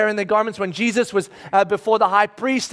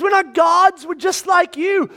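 Paul's urgent cry; 8 semitones higher than usual. Acted. Paul protesting to worshippers, urgent raised voice. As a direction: cry it out to correct the crowd. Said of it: "Paul immediately says".

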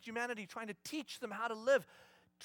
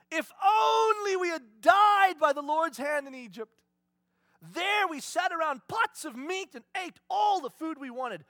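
loud, whining complaint with a cry in it; grumbling Israelites, whining kid voice; whiny grumbling kid; 5 semitones higher, 8 dB louder than usual. Retold or imitated. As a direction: wail like a spoiled child.

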